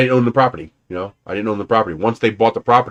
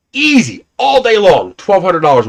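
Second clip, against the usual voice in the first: emphatic high repeat; 7 semitones higher, 4 dB louder than usual. Real, not acted. Seller insisting the trailer would sell easy, emphatic high. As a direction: pitch jumps way up, a bit louder.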